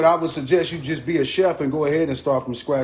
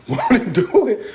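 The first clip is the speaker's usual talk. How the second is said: laughing catchphrase; 7 semitones higher than usual. Real, not acted. Laughing at himself.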